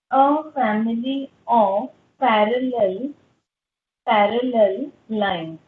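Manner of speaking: slow, emphatic teacher; slow emphatic dictation. Shown as a demonstration. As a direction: press the conclusion home word by word. Teacher stresses key conclusion.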